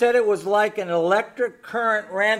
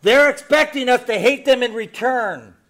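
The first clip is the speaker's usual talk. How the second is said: building preaching; 3 semitones higher, 7 dB louder than usual. Real, not acted. Pastor warning the church not to lash out, building loud. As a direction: rise to a forceful close.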